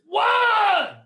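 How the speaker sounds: high, loud yell of the count. Retold or imitated. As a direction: bark it at the lost drummer. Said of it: "Like he's yelling at me".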